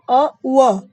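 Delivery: अ said, then व said loudly to show the v sound; sounding out the blend. Shown as a demonstration. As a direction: blend the sounds so व rings out clearly. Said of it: "आप बोल के देखेंगे ना". (अ और व को ज़ोर से जोड़कर बोलना)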